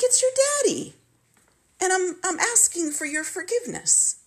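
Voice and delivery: the father begging his daughter for forgiveness; pleading father voice. Retold or imitated. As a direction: plead in a high imitated old-man voice.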